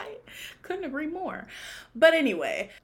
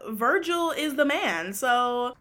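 ordinary delivery

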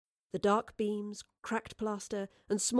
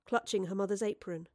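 ordinary delivery

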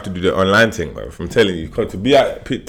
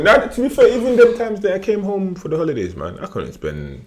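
usual speaking voice

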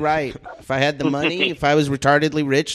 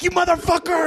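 much higher, reenacted yell at a driver; retold road rage yell. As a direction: shout it like you nearly got rammed. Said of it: "I was like".

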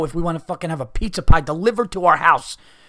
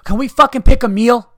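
higher and louder, fed-up yell; exasperated shout; 9 semitones higher, 9 dB louder than usual. Real, not acted. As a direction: blow up at the whole pointless debate. Ranting at the indecision.

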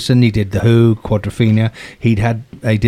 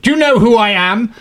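pompous producer; 10 semitones higher, 3 dB louder than usual. Retold or imitated. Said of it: "with the man who said".

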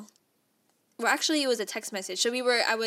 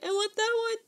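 much higher, eager and thrilled; excited squeal; 13 semitones higher, faster than usual. Retold or imitated. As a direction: pick the puppy instantly. Spotting the perfect pug.

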